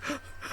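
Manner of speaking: short sharp breathless gasp; imitated gasp. Father finding his daughter's whistle, sharp gasp. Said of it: "he's like".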